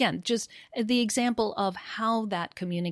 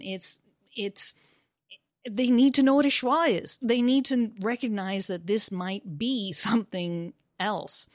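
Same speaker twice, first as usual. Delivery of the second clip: normal talking voice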